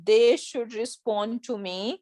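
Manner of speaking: each word drawn out slowly. Shown as a demonstration. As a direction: dictate it word by word. Slow translation.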